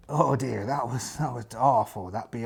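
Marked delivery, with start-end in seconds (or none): none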